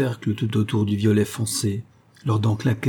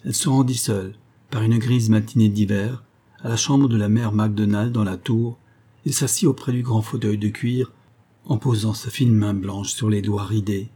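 ordinary delivery, nothing marked

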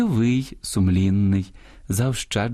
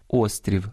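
"Острів" stressed on the opening О; stress on first syllable; 3 semitones lower than usual. Shown as a demonstration. Host showing singular stress, "Острів" with first-syllable stress. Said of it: "зберігають наголос однини".

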